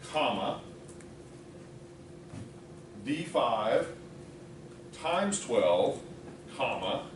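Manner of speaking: slow, spelling out each piece; dictating. Real, not acted. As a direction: read it out slowly for typing. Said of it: "let me read it off to you".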